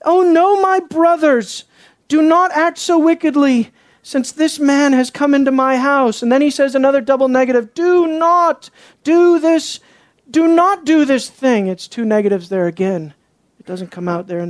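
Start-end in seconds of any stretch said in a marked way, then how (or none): 0.0-6.2 raised, insistent plea; earnest pleading. Acted. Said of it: "He's earnest about it". 7.7-11.7 slow, high "Do not do this"; earnest, emphatic. Acted. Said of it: "He's earnest about it".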